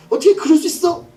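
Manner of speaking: high, acted lover's pout; petulant whining. Woman throwing a fit at Samson, high mock petulance. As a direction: play up a petulant complaint. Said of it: "앙탈이에요".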